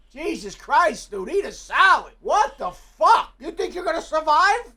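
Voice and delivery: loud, drawn-out disbelief; horrified yelling. Real, not acted. Yelling at a man on TV in horror. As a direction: yell at the screen in shock. Shocked at overweight man onscreen.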